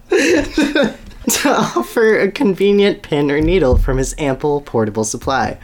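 breaking into laughter mid-sentence; reading a funny line to his co-host and cracking up; laughing while reading. Real, not acted. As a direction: try to read through your own giggles.

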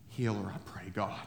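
soft, low plea; humble prayer. Retold or imitated. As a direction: pray it quietly and humbly. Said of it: "That's a humble man".

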